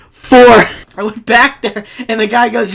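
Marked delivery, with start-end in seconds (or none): 0.1-0.6 a loud, punchy count; proud loud answer. Retold or imitated. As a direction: bark the number out louder, proudly. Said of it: "I said".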